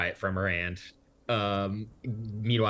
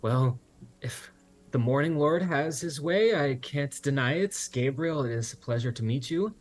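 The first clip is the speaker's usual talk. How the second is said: lightly voiced gracious hostess; 8 semitones higher, 3 dB louder than usual. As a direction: welcome the guests kindly. DM voices hostess welcoming guests.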